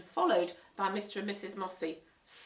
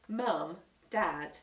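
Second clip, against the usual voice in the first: ordinary delivery, nothing marked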